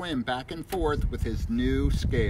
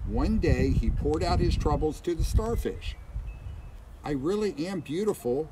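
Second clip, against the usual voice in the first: storybook reading voice; reading to children; 7 dB louder than usual. A narrator's reading. Reads picture book aloud.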